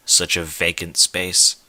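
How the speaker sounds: usual speaking voice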